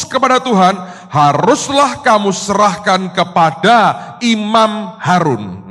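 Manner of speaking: scripture read aloud. Real, not acted. Preacher reading scripture to the congregation, loud and declamatory. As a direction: proclaim the verse at full volume, word by word.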